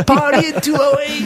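high, loud mock invitation; playful party call. Joking about his hotel room.

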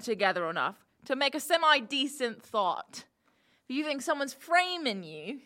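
contemptuous jailer putting prisoners down; scornful jailer. Acted. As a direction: talk down to the idiots in the cell.

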